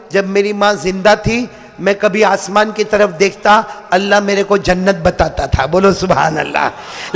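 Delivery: usual speaking voice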